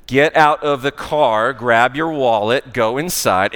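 slow, loud, over-enunciated instructions. Retold or imitated. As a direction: spell it out loudly like to a foreigner. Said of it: "We slow down and we say it louder".

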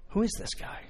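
unvoiced musing whisper; hushed wonder. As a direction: whisper the question to yourself. [name] wondering inwardly.

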